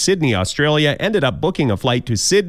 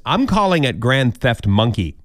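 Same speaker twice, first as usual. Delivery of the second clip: usual speaking voice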